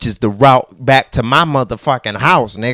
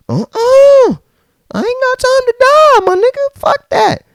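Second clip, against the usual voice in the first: squeaky put-on voice brushing it off; hammed-up high-pitched dismissal; high mock voice; 4 dB louder than usual. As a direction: jump way up high and loud for the refusal. Mocking someone's excited refusal.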